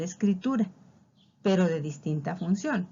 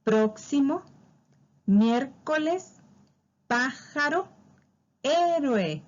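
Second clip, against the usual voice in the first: each word said high with its accent marked; stressed examples; 6 semitones higher, slower than usual. Shown as a demonstration. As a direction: read each esdrújula lifting the stressed syllable. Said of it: "podemos encontrar algunos ejemplos". (ejemplos leídos marcando la sílaba tónica)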